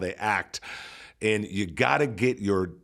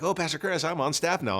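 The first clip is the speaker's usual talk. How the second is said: eager new staffer; 7 semitones higher than usual. Retold or imitated. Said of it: "she's like".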